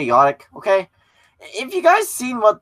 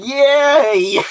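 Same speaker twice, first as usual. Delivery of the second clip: mock crowd cheer; 8 semitones higher, 6 dB louder than usual. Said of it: "crowd's like".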